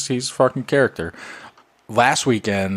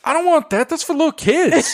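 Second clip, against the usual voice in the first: put-on very high, louder kid voice; indignant little boy; 3 dB louder than usual. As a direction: protest like an offended small kid. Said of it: "he was like".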